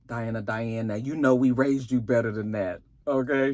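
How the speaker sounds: low mock-stern father scolding his daughter. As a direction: drop deep and lecture her like a disappointed dad.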